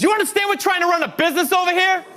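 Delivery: high, fast indignant outburst, imitating an angry woman; mock pissed-off. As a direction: scold them in a high, rushed voice. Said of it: "Marie got pissed. She was not happy".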